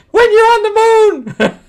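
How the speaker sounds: very high, loud exclamation; incredulous yell. Real, not acted. Reviewer objecting to a fall on the moon, incredulous yell. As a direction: burst out in comic disbelief. Exasperated at the comic's physics.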